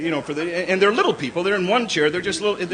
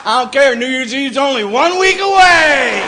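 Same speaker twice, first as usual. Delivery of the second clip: yelling back; 7 semitones higher, 7 dB louder, slower than usual. Retold or imitated. Comic yelling back at wife.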